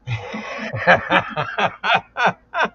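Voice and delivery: high, drawn-out burst of laughter; real laugh, rising in pitch; hearty laugh. Real, not acted. Host laughs at guest's joke.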